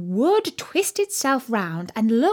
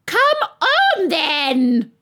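very high, loud royal bellow; roared; 8 semitones higher, 6 dB louder, much slower than usual. A narrator's reading. Queen summons Alice to the procession, roaring. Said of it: "roared the Queen".

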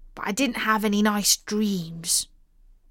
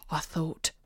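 ordinary delivery, nothing marked

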